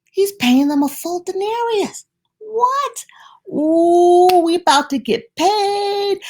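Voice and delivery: high, eager whispering gossip of day workers; voicing the early workers' eager chatter; excited workers. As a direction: gasp with excitement at the news.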